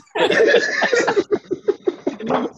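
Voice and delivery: long laugh up high. Real, not acted. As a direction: crack up at the punchline. Laughing at his own story.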